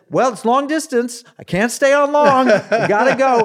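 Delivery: high, loud, hurried-off caller impression; hammed-up high phone voice; imitated Korean pastor. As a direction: call it out comically, as over long distance. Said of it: "a very Korean, broken English voice".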